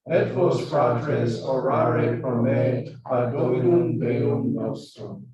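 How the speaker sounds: loud, steady Latin prayer recitation; liturgical recitation. Shown as a demonstration. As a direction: declaim it like leading mass. Recites the Confiteor for practice.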